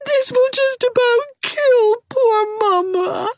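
high, slow, tearful sob; sobbing. A narrator's reading. Hazel sobbing to Anne over her lost love. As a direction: choke the words out through tears. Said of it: "sobbed Hazel".

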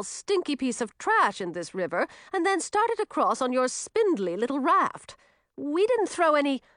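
lightly voiced huffy water nymph; indignant river nymph.

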